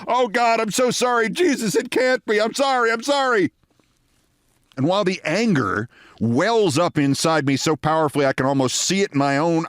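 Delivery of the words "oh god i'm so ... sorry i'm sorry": high, pleading "I'm sorry" over and over; frantic apologies. Retold or imitated. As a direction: beg forgiveness, stricken. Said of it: "Bill is shouting apologies".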